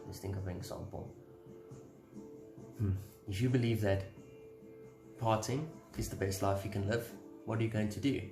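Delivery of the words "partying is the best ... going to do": normal talking voice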